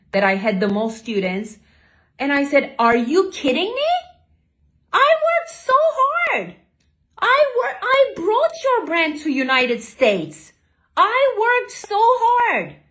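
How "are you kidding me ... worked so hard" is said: very high, strained cry of hurt; retold desperate outcry. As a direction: plead your case, near breaking. Confronting the boss, desperate.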